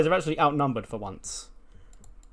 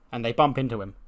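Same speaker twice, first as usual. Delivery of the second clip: ordinary talk, nothing marked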